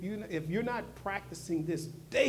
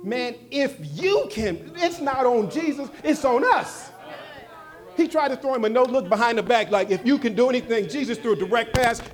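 loud, high-pitched sermon delivery; shouted pulpit rebuttal to the congregation; full-force preaching; 8 semitones higher, 11 dB louder than usual. Acted. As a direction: preach it hard, driving each phrase home.

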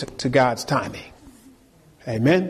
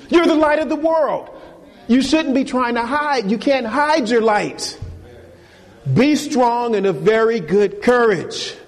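raised, urgent preaching voice; fervent preaching; 8 semitones higher than usual. Acted. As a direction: preach it to the congregation with fire. Preaching full force.

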